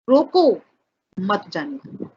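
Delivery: रोको said as its own command, break, then मत जाने दो falling; pause after रोको. Shown as a demonstration. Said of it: "उनके खिलाफ पब्लिक को भड़का दिया". (रोको के बाद विराम)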